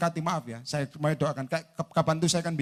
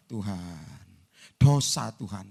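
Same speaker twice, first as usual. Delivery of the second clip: mock piety; 8 semitones lower, slower than usual. Mimics believer refusing blessing as sin.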